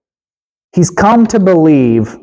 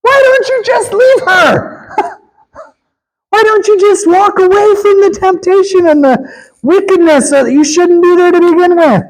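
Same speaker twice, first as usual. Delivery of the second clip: shouting exhortation. Real, not acted.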